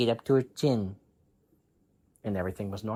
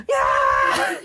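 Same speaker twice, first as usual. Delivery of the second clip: put-on girl's shout; 9 semitones lower, 7 dB louder than usual. Girl responds to mom.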